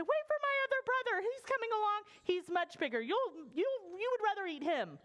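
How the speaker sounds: high little goat, bargaining; little brother goat. Acted. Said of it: "like every little brother out there".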